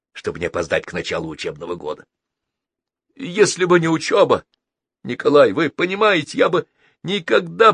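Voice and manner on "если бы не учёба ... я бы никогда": high, emotional, hint of choked-back tears; holding back tears. A narrator's reading. Boy parting from his friend Николай, holding back tears. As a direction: plead it higher, trying not to cry. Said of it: "пробормотал Геннадий, опять же пытаясь сдержать слёзы".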